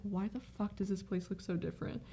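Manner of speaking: hushed, puzzled inner voice; quiet inner thought. Retold or imitated. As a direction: mutter it to yourself, lost. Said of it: "I was like".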